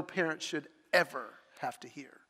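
whispered, grave; hushed whisper. Real, not acted. Father recalling the phone call about his daughter, hushed whisper. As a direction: drop to a whisper for the worst news.

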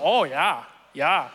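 put-on Wisconsin "Oh, yeah"; faked Northern accent. New kid practising to sound local, faked Northern accent. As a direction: exaggerate the Northern vowels. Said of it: "faking a Northern accent".

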